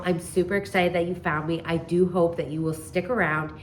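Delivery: ordinary talk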